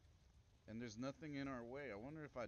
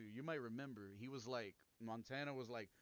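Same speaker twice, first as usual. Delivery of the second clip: ordinary talk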